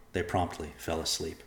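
usual speaking voice

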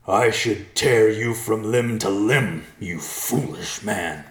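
a bear rearing up to threaten the man; roaring bear. A narrator's reading. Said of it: "stood tall and roared at Ben".

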